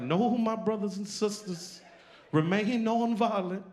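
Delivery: preacher voice. Retold or imitated. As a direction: lift it up like a sermon. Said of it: "And he's like".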